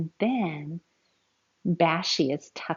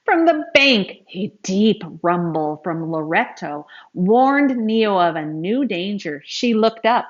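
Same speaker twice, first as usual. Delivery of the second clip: dramatic story narration; 2 semitones higher, 8 dB louder than usual. A narrator's reading. Reading aloud to children as calf sinks, urgent. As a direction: build the panic as the calf sinks.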